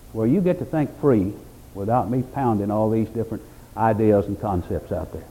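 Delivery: normal talking voice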